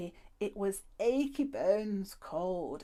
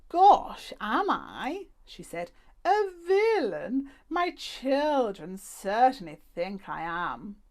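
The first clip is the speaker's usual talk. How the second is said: higher, louder, slow wry surprise; surprised old lady; 3 semitones higher, 10 dB louder, slower than usual. A narrator's reading. The old lady accused of villainy, higher and surprised. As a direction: act amazed, then wry. Madame Badobadar voiced.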